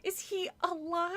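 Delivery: high, tight-throated question; scared girl voice asking; frightened. A narrator's reading. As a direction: ask it afraid of the answer. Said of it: "her throat tight with fear".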